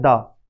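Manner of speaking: "दा" alone, low and without stress; unstressed syllable. Shown as a demonstration. Teacher showing students the syllable without stress, low unstressed "दा". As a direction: drop low on an unweighted "दा". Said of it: "stress नहीं लग रहा".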